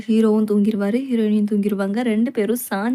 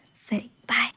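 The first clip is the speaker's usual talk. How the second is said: whispered bye; 2 semitones lower, 6 dB quieter, slower than usual. Acted. Scolded, says bye to sleepers.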